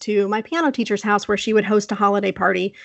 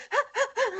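high, quick breathy laugh-pants; giddy little high puffs; excited panting; 8 dB quieter than usual. Retold or imitated. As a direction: imitate an over-excited kid puffing. Imitates herself as excited child.

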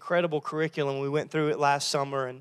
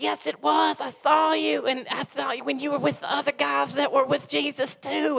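put-on higher country girl voice; country accent; 9 semitones higher than usual. Girl pressing Peter in a country accent. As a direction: twang it out accusingly. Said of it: "country accent".

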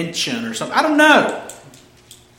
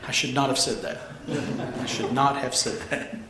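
Hagrid regret; 6 semitones lower, 10 dB quieter than usual. Retold or imitated. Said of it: "pulling a Hagrid".

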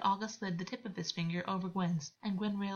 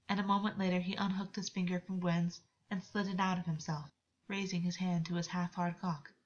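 usual speaking voice

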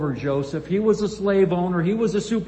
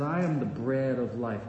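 softer and lower, almost to himself; quiet, awed restatement; hushed, reverent; 7 semitones lower, 5 dB quieter than usual. Acted. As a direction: let "I am the bread of life" sink in quietly. The same claim said low and soft.

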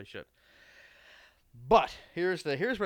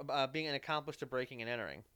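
ordinary delivery

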